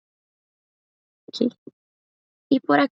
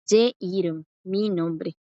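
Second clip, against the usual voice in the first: usual speaking voice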